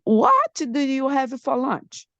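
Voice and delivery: high emphatic question, falling on 'lunch'; so hungry, emotional. Shown as a demonstration. Student acting so hungry for teacher, high emphatic question. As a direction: push 'What' up high, as if very hungry. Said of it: "I mean, I'm so hungry".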